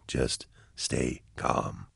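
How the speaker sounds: very slow, soft whisper. Acted. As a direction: breathe the words out slowly. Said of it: "Why are you whispering?".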